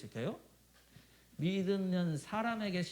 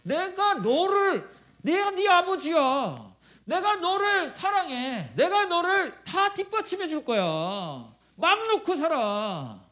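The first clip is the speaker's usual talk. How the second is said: high, loud, drawn-out declaration; preacher voicing God the Father at full force; fatherly proclamation; 9 semitones higher, 7 dB louder, slower than usual. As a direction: proclaim each phrase slowly and forcefully.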